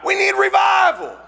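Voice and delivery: high ringing preacher's shout. Real, not acted. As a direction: cry it out to the whole church. Opening cry to the congregation.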